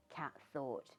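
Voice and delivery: ordinary delivery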